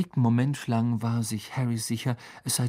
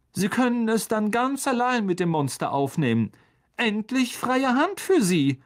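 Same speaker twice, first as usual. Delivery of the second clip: cool sarcasm; 9 semitones higher than usual. A narrator's reading. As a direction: hand him his doom politely. McGonagall dismisses Lockhart.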